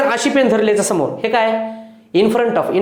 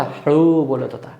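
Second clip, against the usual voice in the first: slow, lower, drawn-out हळू; slow demonstration; 6 semitones lower, slower than usual. Shown as a demonstration. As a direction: stretch हळू out low and easy. Acts out speaking softly, slowly.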